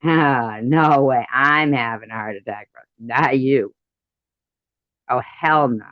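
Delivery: loud indignant yelling at a friend; loud mock outrage, fighting to go first; outraged refusal. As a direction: shout it like you refuse to die second. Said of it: "I would be like".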